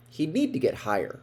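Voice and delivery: ordinary delivery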